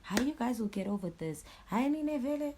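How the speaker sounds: high-pitched mocking imitation; making fun. Cops ridiculing both sides of the dispute, making fun. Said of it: "still part of making fun".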